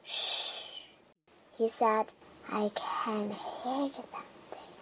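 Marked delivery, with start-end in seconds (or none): 0.0-0.8 voiceless shh; hushing. A narrator's reading. Mole silencing the children, whispered shh. Mole hears something in tunnel. 2.5-4.7 voiceless whisper. A narrator's reading. Mole warning the children in the tunnel, whispering.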